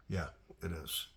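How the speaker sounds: normal talking voice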